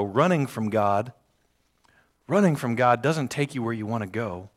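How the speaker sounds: usual speaking voice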